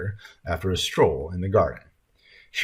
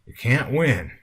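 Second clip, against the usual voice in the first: lightly voiced weary man, pitched up; sighing yodel; 5 semitones higher, slower than usual. A narrator's reading. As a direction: lift it into a mild yodelling complaint. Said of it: "let out a combination sigh and yodel".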